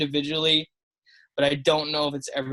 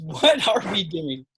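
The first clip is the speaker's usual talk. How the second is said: slow, drawn-out mock exasperation; Taylor Twellman; 3 semitones higher, slower than usual. Co-host repeating the pundit's catchphrase, mock exasperated. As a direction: lean hard into the first word, exasperated. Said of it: "do your Taylor Twellman impersonation".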